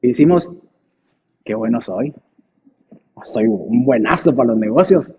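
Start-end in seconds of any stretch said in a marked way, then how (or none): 1.4-5.1 smug boast. Retold or imitated. Mimics smug prosperous believer.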